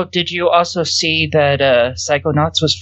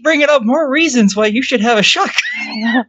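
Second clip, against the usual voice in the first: teasing laugh; 11 semitones higher than usual. Real, not acted. Teasing, high voice.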